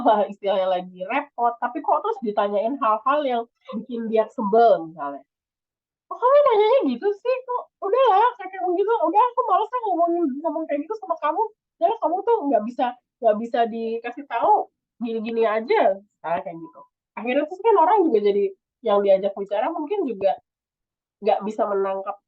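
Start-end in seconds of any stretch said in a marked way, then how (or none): 6.1-16.0 high, fast irritated complaint; annoyed snapping. Acted. Friend in a bad mood snapping at a nosy question, high and fast. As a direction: snap back like a fed-up friend.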